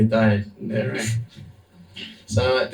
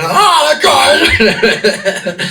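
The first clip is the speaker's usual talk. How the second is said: high laughing shout, much louder; laughing mock yell; 14 semitones higher, 10 dB louder, much slower than usual. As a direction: yell it half laughing as you replay the panic. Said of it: "I yelled the mantra at him".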